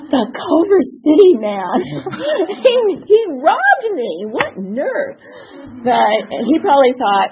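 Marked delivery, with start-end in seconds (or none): 0.1-5.2 high, slow, laughing indignation; mock-outraged through laughter; higher laughing what-nerve outrage. Outrage at being robbed.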